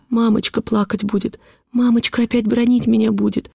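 put-on high, plaintive little girl's voice; anguished child. A narrator's reading. Girl wringing her hands fears mother's scolding, anguished. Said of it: "говорила я, ломая руки".

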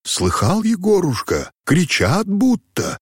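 put-on high-pitched worried old man's question; anxious, agitated. A narrator's reading. Old man to his coachman about shouts in the forest, anxious. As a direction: ask it uneasily, voice lifting high. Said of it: "взволнованный голос тревожно спросил".

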